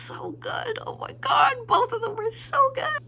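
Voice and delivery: high squeal of delight; gushing fan. Real, not acted. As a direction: gush in a high, overcome squeak. Gushing over the unreleased songs.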